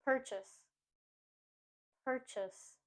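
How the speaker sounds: Purchase as "PUR-chus"; strong PUR, weak chus in Purchase; stress on first syllable. Shown as a demonstration. Teacher modelling purchase for learners, PUR-chus. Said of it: "we put the stress on the first syllable".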